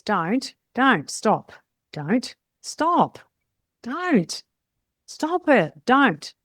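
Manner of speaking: sharp, rising then falling commands; firm commands. Shown as a demonstration. Salon owner to staff, stern stop commands. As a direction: bark each word to make them stop. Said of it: "the way I'm saying it is that I want you to".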